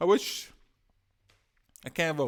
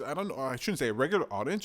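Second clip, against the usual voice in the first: ordinary talk, nothing marked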